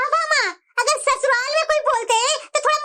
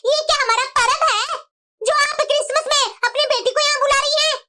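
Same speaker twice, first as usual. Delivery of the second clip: normal talking voice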